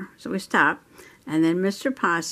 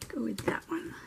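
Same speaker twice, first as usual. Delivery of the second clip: whisper; 7 semitones higher, 12 dB quieter than usual. Real, not acted. Whispered choice while coloring.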